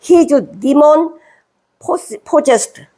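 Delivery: very slow, deliberate English words; slow reading. Shown as a demonstration. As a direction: spell out the verse slowly. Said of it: "악령이 너 들렸구나". (아주 느리게 또박또박 읽기)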